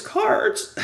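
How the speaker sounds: Cards! squeaked very high, breathy and cracked; strained cry of Cards! that breaks into air; voice gives out. Real, not acted. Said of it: "I'm losing my voice".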